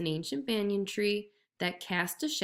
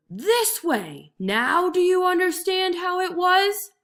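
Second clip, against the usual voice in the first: loud, slow, furious tiger shout; bellowing; 10 semitones higher, 7 dB louder, slower than usual. A narrator's reading. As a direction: roar it at him.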